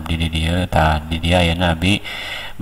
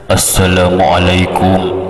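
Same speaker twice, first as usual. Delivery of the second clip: slow, measured salam; slow, level preacher's greeting; lowered dignified tone; much slower than usual. Shown as a demonstration. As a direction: stretch the greeting out with authority. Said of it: "nadana diturunkeun, rada handap".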